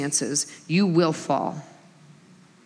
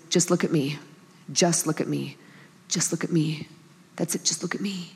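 soft, hushed fatherly coaxing, fading softer; gentle reassurance; 3 semitones lower, 5 dB quieter than usual. Retold or imitated. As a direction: calmly hold a child's gaze. Father soothing son in bathroom.

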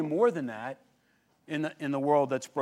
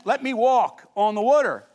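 "Let me walk on the water" louder and higher, eager; eager Peter; 6 semitones higher, 7 dB louder than usual. Retold or imitated. Peter eagerly begs Jesus to let him walk, louder. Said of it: "it means".

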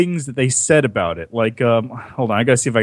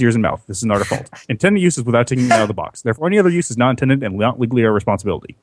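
rapid-fire legal small print; fast disclaimer; faster than usual. Acted. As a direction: rattle off the fine print fast. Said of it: "this is the advertisement".

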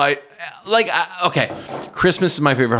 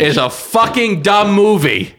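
loud sharp voice; 8 semitones higher, slower than usual. Real, not acted. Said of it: "why are you yelling".

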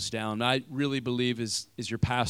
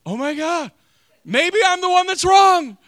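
dawning realization; 11 dB louder, slower than usual. Retold or imitated. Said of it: "I began to realize".

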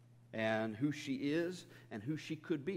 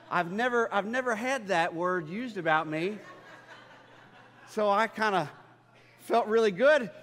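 laughing while telling it, louder and higher; 7 semitones higher, 8 dB louder than usual. Real, not acted. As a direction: chuckle through the words, tickled. Laughing at the compliment.

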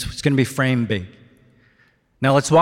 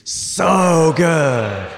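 gut yell; 3 semitones higher, 4 dB louder than usual. Shown as a demonstration. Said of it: "One, two, three".

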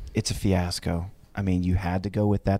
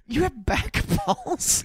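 high slow disbelief; slower than usual. Real, not acted. Incredulous question.

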